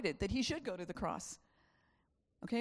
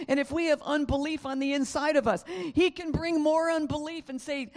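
loud, high impassioned sermon; full-force preaching; 7 semitones higher, 8 dB louder than usual. Real, not acted. Preacher pleads loudly with the congregation to see now.

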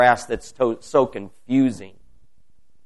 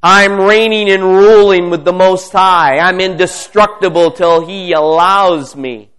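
triumphant declaration; 5 semitones higher, 8 dB louder than usual. Real, not acted.

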